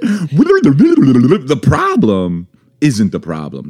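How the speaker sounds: babbled rewind noise into a replayed quote; mock tape rewind. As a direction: imitate rewinding a recording. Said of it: "That was me rewinding".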